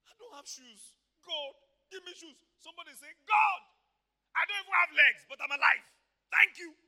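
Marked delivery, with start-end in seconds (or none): none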